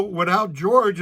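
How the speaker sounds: ordinary talk, nothing marked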